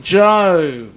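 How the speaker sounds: loud call of the name; host hails viewer Joe, loud and sudden. Real, not acted.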